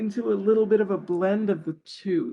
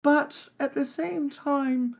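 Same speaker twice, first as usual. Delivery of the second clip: higher voice, a lightly voiced Cockney-posh elf blend; Farthing's voice; 6 semitones higher than usual. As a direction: answer in character as the scrappy elf rogue. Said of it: "Farthing, do you think money can buy happiness?".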